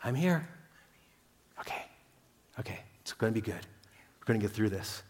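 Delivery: soft, hushed reassurance; little voice. Retold or imitated. God's little voice reassuring a struggling believer, hushed. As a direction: whisper comfort close to the ear. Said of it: "that little voice saying".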